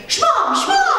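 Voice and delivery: high squeaky sneeze sounding like a word; dog sneeze as a word. Retold or imitated. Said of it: "it sounded like he was saying".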